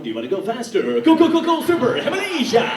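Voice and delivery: loud, rising fairground hype; hyped ride DJ. Retold or imitated. DJ in the booth revving up riders on the Himalaya, shouting hype. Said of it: "DJ booth going".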